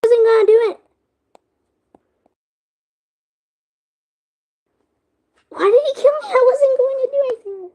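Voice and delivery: loud, high outraged protest; angry shout. Real, not acted. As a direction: yell it in disbelief. Killed in game, protesting.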